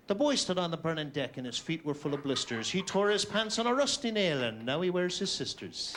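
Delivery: lightly voiced father's recitation; rhythmic nonsense-verse delivery from a father; dad reciting poetry. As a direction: recite like a proud little Irish dad. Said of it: "say things to me like".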